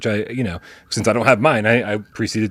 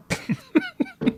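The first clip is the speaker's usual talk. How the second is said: high squeaky laugh; 6 dB quieter than usual. Real, not acted. Laughing at own joke.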